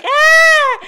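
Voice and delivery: high, loud mock scream; retold scream. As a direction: shriek it like a retold panic moment. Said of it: "I'm screaming".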